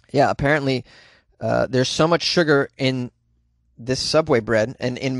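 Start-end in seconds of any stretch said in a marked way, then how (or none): none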